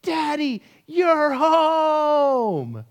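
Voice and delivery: high, loud squeal of joy; excited four-year-old, voice shooting up; delighted child's yell. Retold or imitated. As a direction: imitate a little girl yelling to greet her dad. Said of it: "yells with, as much delight and excitement".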